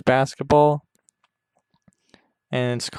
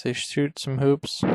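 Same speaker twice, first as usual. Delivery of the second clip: ordinary talk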